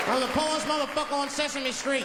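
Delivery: high, strained indignant outburst; Oscar. Acted. Trash can dweller answering judgmental kids, high indignant outburst. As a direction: protest hotly with pitch pushed up. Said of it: "They got a character on there named Oscar".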